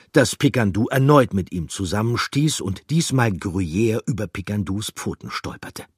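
ordinary delivery, nothing marked

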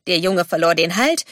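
plain reading voice

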